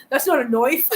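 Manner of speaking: put-on Australian accent. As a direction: imitate Crocodile Dundee in a broad Australian accent. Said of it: "that's my best Australian accent".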